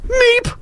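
very high tiny mouse squeak; startled squeak. Retold or imitated. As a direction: squeak like a stunned deer. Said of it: "thinking, you know".

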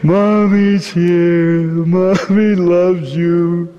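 slow, grieving hush; wailing lullaby. Acted. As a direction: soothe through sorrow, very slow. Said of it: "she wails".

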